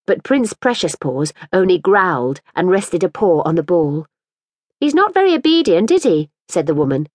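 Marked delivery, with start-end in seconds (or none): 4.8-6.3 put-on a higher, anxious young mother asking politely; lightly voiced fretful mother. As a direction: plead a little for the ball back. Woman character's voice.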